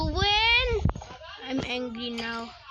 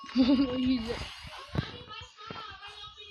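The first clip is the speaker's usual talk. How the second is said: giggle; 8 semitones higher, 3 dB quieter than usual. Real, not acted. Laughing at in-game mishap.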